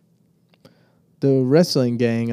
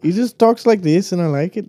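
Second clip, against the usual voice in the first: a mock Irish accent sliding toward Indian, higher and louder; slipping accent; 6 semitones higher, 4 dB louder than usual. Friend points out that his mock Irish accent has slid into Indian. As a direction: lose the Irish brogue mid-phrase. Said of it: "Now you're doing Indian".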